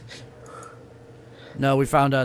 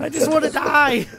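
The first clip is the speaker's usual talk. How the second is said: high, loud hammed-up wail; mock despairing wail; 8 dB louder than usual. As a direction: cry it out in comic despair.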